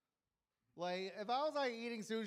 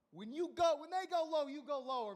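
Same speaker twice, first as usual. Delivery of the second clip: louder, higher triumphant proclamation; triumphant boast; 7 semitones higher, 7 dB louder than usual. Real, not acted. Bragging to the crowd about beating his nemesis, triumphant crow. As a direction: crow it like a victory speech.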